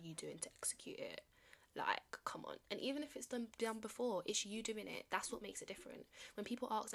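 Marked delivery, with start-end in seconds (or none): none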